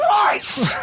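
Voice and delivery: high laughing shout of Christ; mock shout. Friend playfully shouts the oath while laughing, high. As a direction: blurt it in a hammed-up yell.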